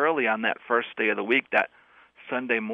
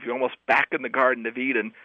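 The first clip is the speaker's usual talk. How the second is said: normal talking voice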